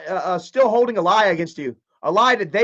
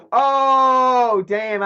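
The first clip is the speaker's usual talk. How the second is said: much higher and louder oh; sudden realization; 9 semitones higher, 6 dB louder than usual. Real, not acted. Vlogger reading a chat comment, suddenly realizing. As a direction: caught off guard by a comment.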